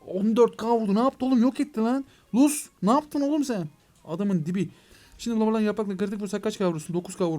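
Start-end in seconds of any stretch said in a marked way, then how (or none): 0.1-3.7 high, loud, amazed shouts; excited yelling. Real, not acted. Gamer cheering his dragon's massive hit, excited yells. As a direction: yell in disbelief at the huge hit. Huge crit hit in battle.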